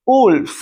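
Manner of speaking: उल्फ high with a short u; model word wolf. Shown as a demonstration. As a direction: clip the u in उल्फ. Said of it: "इसे उल्फ पढ़ते हैं". (उल्फ छोटे उ के साथ)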